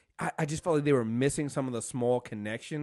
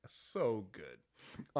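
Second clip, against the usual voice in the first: ordinary delivery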